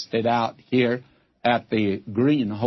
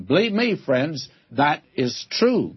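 ordinary talk

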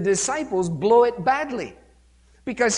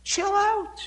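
high, dismissive shushing; crowd shushing; 10 semitones higher than usual. Retold or imitated. Crowd telling the blind man to shut up, high and dismissive. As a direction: brush the beggar off. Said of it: "the crowd are going".

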